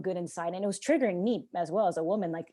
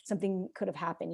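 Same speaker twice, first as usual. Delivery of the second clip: usual speaking voice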